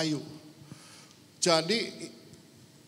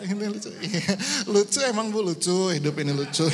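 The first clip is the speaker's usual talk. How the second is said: laughing along; 3 semitones higher than usual. Real, not acted. Menanggapi ibu-ibu sambil tertawa.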